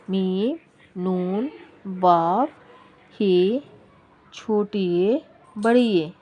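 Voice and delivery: slow letter-by-letter recital with lilting rises; teacher's sing-song letter drill; alphabet recitation. Shown as a demonstration.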